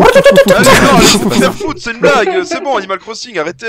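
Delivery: high, loud flustered protest; panicked protest. Real, not acted. (protestation paniquée)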